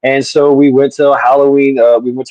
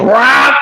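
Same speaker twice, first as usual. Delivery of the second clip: imitated fart noise; 12 semitones higher than usual. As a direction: blurt out a cartoon fart sound. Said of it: "lifts up her leg and goes".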